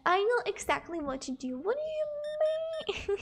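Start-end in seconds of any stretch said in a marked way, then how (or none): 1.6-3.2 high, drawn-out whine of disbelief; mock-indignant protest. Real, not acted. Self-declared professional questioned, whining in mock disbelief. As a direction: stretch the last word up high in playful protest.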